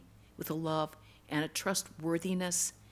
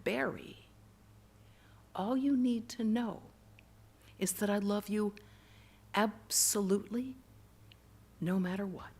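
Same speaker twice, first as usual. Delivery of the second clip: loving, trustworthy voice. Retold or imitated. God's voice comforts the raging boy, loving and gentle. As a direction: give each phrase room, tenderly. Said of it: "most beautiful, honest, loving, softest".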